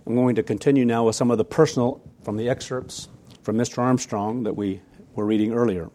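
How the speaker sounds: ordinary talk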